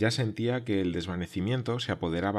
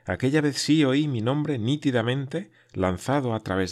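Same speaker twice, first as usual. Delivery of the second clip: sí pushed louder and higher in triumph; stress on sí; 4 semitones higher, 4 dB louder than usual. A narrator's reading. Hearing his name at last, stressing sí. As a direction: land hard on the word sí.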